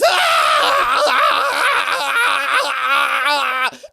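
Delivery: long high loud shout; falling scream. Shown as a demonstration. Actor demonstrating a cliff-fall death scream. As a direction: yell as you fall off a cliff. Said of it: "falling off a cliff".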